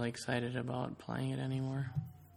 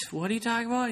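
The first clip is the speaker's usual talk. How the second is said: much higher incredulous question; mock bafflement; 10 semitones higher, 3 dB louder, much faster than usual. Imitating herself and Russell baffled by the drunk, high and incredulous. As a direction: act out the stunned friends. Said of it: "me and Russell are like".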